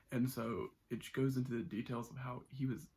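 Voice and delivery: ordinary talk, nothing marked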